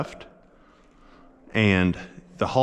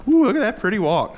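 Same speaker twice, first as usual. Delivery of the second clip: delighted; 11 semitones higher, 4 dB louder, faster than usual. Real, not acted. Delighted at horse's walk.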